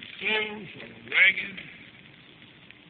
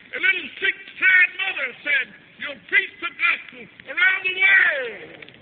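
high, slow, crying out to heaven; impassioned prayer; 10 semitones higher, 7 dB louder, slower than usual. Real, not acted. Preacher pleads with the Lord at lake's edge, impassioned prayer. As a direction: call on the Lord with drawn-out fervor.

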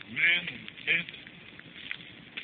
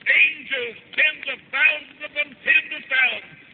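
high, ringing, rhythmic proclamation; full-force preaching; 7 semitones higher, 6 dB louder than usual. Real, not acted. Preacher proclaims heaven to the congregation, full-force preaching. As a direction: chant out each promise in falling cadences.

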